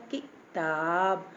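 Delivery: किताब with a quick "ki", then a long "taab"; quick short vowel. Shown as a demonstration. Teacher showing the short i matra, quick first syllable. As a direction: clip the ki of किताब, stretch the taab. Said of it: "ये जल्दी से निकल गया".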